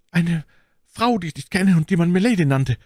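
high, loud, hurried confession; frightened bandit. Acted. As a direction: confess quickly to save your skin.

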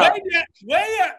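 shouted out loud and high; excited call. Real, not acted. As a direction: call it out across the room. Host calls out excitedly.

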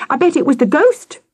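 put-on high, excited child; shouted. A narrator's reading. Victoria blaming the ghost, shouting high. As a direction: blurt it out, pitched high. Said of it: "Victoria shouted".

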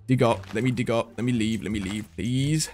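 low, soft muttered begging; hushed pleading. Real, not acted. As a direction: plead under your breath to get away. Begging to escape the raid.

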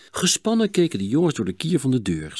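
ordinary delivery, nothing marked

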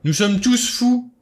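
high, loud, drawn-out cry; violent outburst. A narrator's reading. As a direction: shout it slowly, nerves snapping. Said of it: "lança-t-il avec violence".

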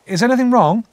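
high, loud, anxious question; anxious young Pip. A narrator's reading. Pip questions the unseen stranger, anxious. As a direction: ask it urgently, pitched well up.

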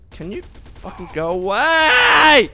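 slow, fed-up yell; angry shout. Real, not acted. Gamer yelling at an unkillable enemy, angry. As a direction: shout it out, stretching each word.

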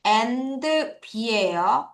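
between A said very slowly for note-taking; dictation pace. Shown as a demonstration. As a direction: say it slowly so they can write it down. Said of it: "필기를 해봐야 되겠습니다". (필기하도록 아주 천천히)

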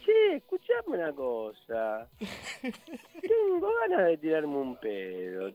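slow, drawn-out put-on voice; imitación del compañero. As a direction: stretch the vowels in deadpan mimicry. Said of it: "Y te lo dice así". (voz imitada, lenta y arrastrada)

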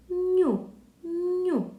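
Ню said high with a soft palatal н; Ню, soft н glides into у; soft consonant demo. Shown as a demonstration. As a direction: sound out a soft ню. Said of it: "І звук Н стає м'якенький".

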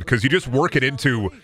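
usual speaking voice

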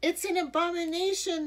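high, loud outraged shout; scandalized crowd cry. A narrator's reading. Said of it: "the crowd shouted".

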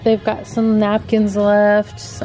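ordinary talk

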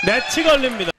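loud, high commentary burst; excited net call. Real, not acted. Commentator calling a ball into the net, loud and high.

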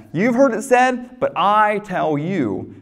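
slow "but I tell you", with the "I" leaned on hard; preacher punching "I" in "but I tell you"; stress on I. Shown as a demonstration. Preacher repeating Jesus's phrase.

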